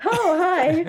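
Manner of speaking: high, loud, laughing hello; giggly flustered reply. Retold or imitated. Answering British players' compliments in Discord, giggly and flustered. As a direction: giggle through a flattered greeting.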